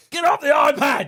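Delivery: exasperated parent yelling; mock yell. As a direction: bark it like a fed-up dad.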